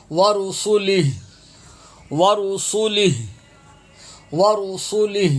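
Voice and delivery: "ورسلہ" stopped on a breathed h, no final vowel; vowelless final h. Shown as a demonstration. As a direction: end "ورسلہ" as wa-rusulih, pausing on the h. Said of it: "ہا ساکن ہو جائے گا".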